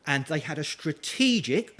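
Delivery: usual speaking voice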